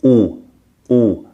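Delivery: ooh with lips in a tight circle; French ou. Shown as a demonstration. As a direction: ooh drawn out slowly through tightly rounded lips. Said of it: "Here you're gonna say".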